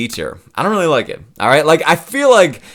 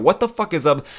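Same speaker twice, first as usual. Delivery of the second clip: ordinary talk, nothing marked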